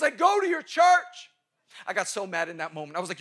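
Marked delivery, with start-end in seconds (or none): 0.0-1.3 put-on high, slow, loud proud girl. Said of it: "She goes".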